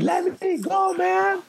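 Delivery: very high, squealing plea; mock squeal of a panicking thief; panicked thief. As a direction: whine like a dragged, terrified man. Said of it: "that guy's like".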